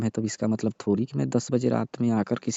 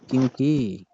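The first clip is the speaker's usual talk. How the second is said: angry; 4 semitones higher, 6 dB louder, slower than usual. Acted. Angry reproach over broken promise.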